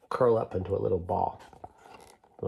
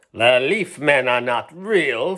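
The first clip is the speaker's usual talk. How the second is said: louder, slow sneer; 3 semitones higher, 8 dB louder, slower than usual. Acted. Spider Queen mocking the toy, sneering. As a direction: scoff with contempt. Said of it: "sneered the Spider Queen".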